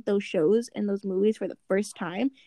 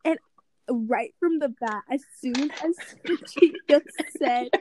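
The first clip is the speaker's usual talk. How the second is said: usual speaking voice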